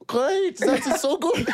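very high, forced German cheer; German accent. Acted. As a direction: squeak it out in a fake German accent. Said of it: "I have to keep this character on".

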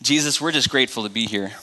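soft, low prayer voice. Acted. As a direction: pray quietly and sincerely. Opens prayer.

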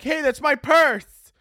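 high, drawn-out mock cry; imitated mugging victim. Victim in the alley next door, hammed-up protest. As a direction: wail it like a distant victim. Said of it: "you hear someone be like".

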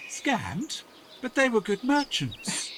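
high, slow protesting disbelief; stunned old villager pleading the merchants' case; shocked disbelief. Acted. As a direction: refuse to believe you were cheated. Villager shocked at being cheated.

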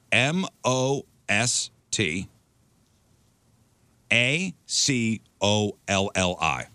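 slow, letter by letter; spelling it out. Shown as a demonstration. Host slowly spells the pasta name for a guest. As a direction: space out each letter carefully. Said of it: "I'm gonna spell it out".

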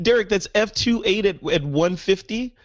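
can't believe what he heard; amazed disbelief. Real, not acted. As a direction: pitch jumps up in disbelief. Amazed at lens spec.

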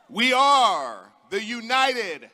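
loud, high rally shouting; roaring rallying speech. Real, not acted. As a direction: rouse the crowd, full force.

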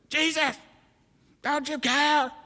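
high, pleading complaint to Jesus; mock whiny plea. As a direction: whine about the broken refrigerator. Mock prayer over broken fridge.

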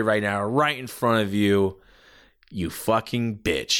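acted wrestling-heel menace; menacing threat. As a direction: draw out each threat with weight. Heel threatens mom in promo.

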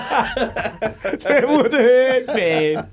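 high, very slow words through laughter; co-host laughing at the painful listener joke; laughing wince. Real, not acted. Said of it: "that would be no good".

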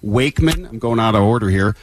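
loud, upbeat on-air DJ intro; radio host intro. Acted. DJ opening a live backstage interview, loud on-air energy. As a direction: hype the guest like live radio.

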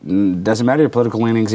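normal talking voice

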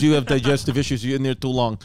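a low imitation of a Russian mover in a Russian accent; mock Russian mover. Retelling the Russian mover's words.

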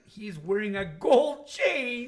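loud, high, drawn-out disbelief; mock outrage. Real, not acted. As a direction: cry it out, scandalized, to tease him. Mock outrage at co-host's gold chain.

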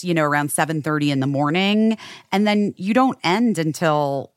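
usual speaking voice

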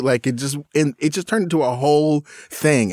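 usual speaking voice

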